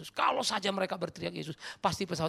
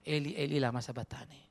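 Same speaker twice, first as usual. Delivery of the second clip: ordinary talk, nothing marked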